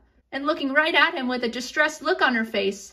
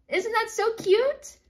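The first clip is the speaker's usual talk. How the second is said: cooing; 8 semitones higher, slower than usual. Real, not acted. Narrator gushes to listeners about a toddler nickname, slow cooing. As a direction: gush over how sweet it is. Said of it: "Isn't that adorable?".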